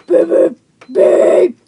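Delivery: loud, punchy beeps. Shown as a demonstration. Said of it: "Or like".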